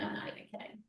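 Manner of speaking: whispered aside; hushed, breathy insistence. Real, not acted.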